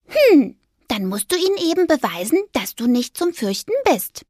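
high squeaky mouse voice; little mouse. Acted. Mouse urging the ghost on, high and squeaky.

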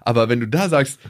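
normal talking voice

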